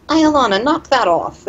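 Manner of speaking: high, sharp scolding of a pet. Acted. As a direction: tell the pet off firmly. Scolding the sprite.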